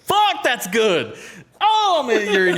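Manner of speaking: laughing hard, high-pitched. Real, not acted. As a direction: crack up at the joke. Cracking up at slogan.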